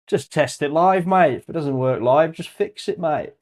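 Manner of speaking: hammed-up Aussie mate, laughing it off; Australian accent. Teasing viewers about testing live in an Australian accent. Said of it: "I'm putting on an Australian accent".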